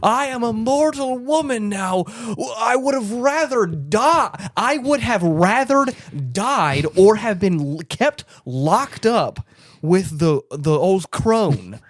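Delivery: high, drawn-out mock wail; melodramatic lament. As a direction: wail it slowly like an overwrought heroine. Acted melodramatic lament of the unicorn.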